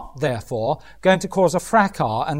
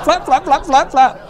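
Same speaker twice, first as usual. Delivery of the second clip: higher, laughing flap flap; mock flapping; 7 semitones higher, 3 dB louder than usual. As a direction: mimic a fluttering panic, half laughing. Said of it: "getting all emotional and flappy".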